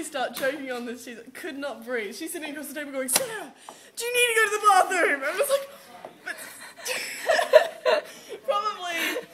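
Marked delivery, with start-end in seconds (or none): 3.1-5.2 high, quick, laughing concern; a friend asking fast, half laughing; high rushed "do you need to go to the bathroom?"; worried friend. Retold or imitated. Friend voiced in story. 5.3-9.3 high, breathless laughter; wheezing laugh while retelling choking; laughing fit. Real, not acted.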